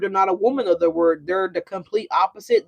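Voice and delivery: ordinary talk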